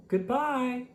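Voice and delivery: high, carried call of farewell; calling goodbye. Acted. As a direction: call it after the departing sled. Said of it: "stood calling".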